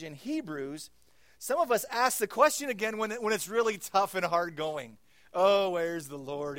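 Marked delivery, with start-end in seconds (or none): 1.4-6.6 high, loud impassioned preaching; preaching at full force. Real, not acted. Pastor urging the congregation, loud impassioned preaching. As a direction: proclaim it with fire.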